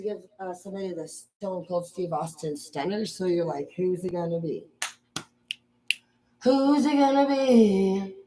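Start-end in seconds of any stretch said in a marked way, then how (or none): 6.4-8.2 slow, high, stretched question; drawn-out taunt. Acted. Picking a stunner victim, slow high drawn-out taunt. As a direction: draw out each word like a challenge.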